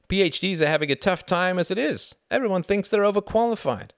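high, hearty, insistent character voice; persuasive Frederick. A narrator's reading. Frederick urges Reginald toward the Foreign Office, high and persuasive.

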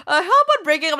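high-pitched, amused mock suggestion; giggly quoted advice; laughing retelling. Laughing at retold dating advice.

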